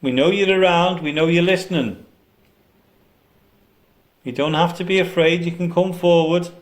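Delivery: loud coaxing call to spirits. Real, not acted. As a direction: coax the spirit out loudly.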